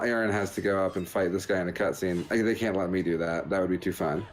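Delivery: usual speaking voice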